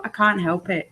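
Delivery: usual speaking voice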